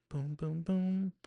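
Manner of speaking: high, sustained piano notes sung as boom; imitated soft piano. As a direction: hum out a soft rising piano motif. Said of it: "it plays that soft music, that piano".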